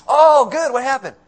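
high, loud, gleeful question; eager delight. Retold or imitated. Neighbours ask Jonah about Nineveh's doom, eager delight. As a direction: pounce on the good news.

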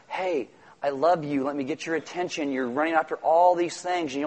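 hushed, unhurried reassurance; gentle appeal. Acted. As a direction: gently draw the listener in. Voicing Jesus gently addressing Martha.

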